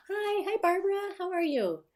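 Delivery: high, excited hello; high-pitched greeting. Real, not acted. Greeting a viewer by name.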